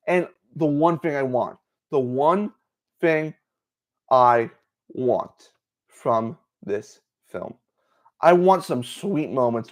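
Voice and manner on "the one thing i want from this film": very slow, word by word; slow emphasis. Real, not acted. Fan spelling out the one wish for this film, slow emphasis. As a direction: space out each word, with pauses between.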